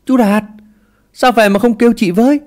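high, loud call after someone; high-pitched shout down the road. A narrator's reading. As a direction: yell it out to catch him. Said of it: "Mụ hét lên".

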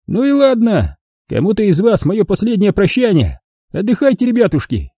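put-on high, gloating old man voice; a cruel old miner mocking his victim; old prospector. A narrator's reading. Old man says farewell.

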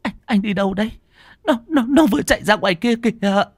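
high, stammering terrified wife; frightened. A narrator's reading. (vợ sợ hãi lắp bắp nói với chồng)

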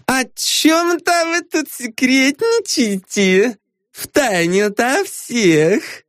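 high, slow tipsy giggle-voice; giggly drunk. A narrator's reading. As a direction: tease like a tipsy gossip. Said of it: "пьяно хихикнула она".

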